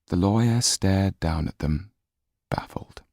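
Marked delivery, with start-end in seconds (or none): none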